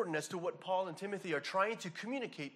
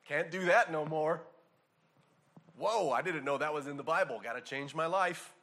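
mock eager reader; 2 semitones lower, 6 dB louder than usual. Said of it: "read the Bible and just go".

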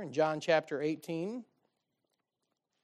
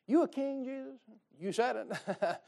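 teasing, knowing comeback; amused retort; 5 semitones higher than usual. Retold or imitated. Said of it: "this is how I hear he says it".

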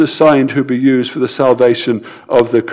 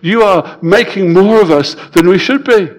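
Paul pleading, rising higher toward the end; voicing Paul; 6 semitones higher than usual. Preacher voicing Paul rebuking the church, pleading higher. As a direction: plead as Paul, pitch climbing. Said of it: "This is what he's underlining".

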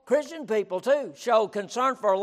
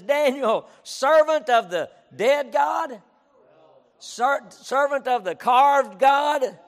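loud, slow, raised mock proclamation; mock calling out; 5 semitones higher, 6 dB louder, slower than usual. Preacher testing false versions of the king's cry, mock calling out. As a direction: call out the wrong title in disbelief.

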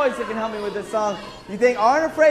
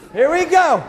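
building hype; 6 semitones higher than usual. Real, not acted. Revving kids up to sing.

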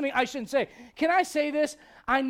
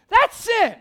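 emphatic declaration; 8 semitones higher, 7 dB louder than usual. Real, not acted. Preacher declares a verdict.

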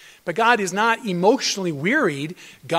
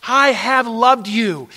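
slow, loud, tender voice of God; a lightly voiced God, slow and loud; voicing God; 5 semitones higher, 9 dB louder, much slower than usual. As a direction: speak as God, slow and sure. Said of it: "this passage is just riddled with grace".

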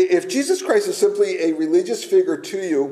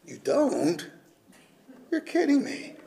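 mock disbelief; 6 semitones higher, 4 dB quieter than usual. Mock surprise at audience.

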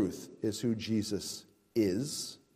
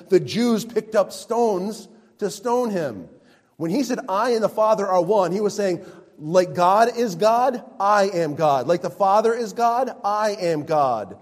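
louder, raised voice, slowing on "I am God"; fervent preaching; 7 semitones higher, 8 dB louder than usual. Acted. Pastor driving home Jesus' divinity, fervent preaching. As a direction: press the claim home with fire.

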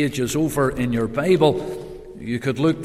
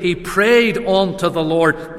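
normal talking voice

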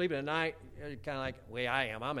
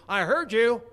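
high, very loud mock holler; insistent holler; 9 semitones higher, 13 dB louder, slower than usual. Wife insists to husband downstairs, insistent holler. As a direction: insist loudly, imitating a wife upstairs.

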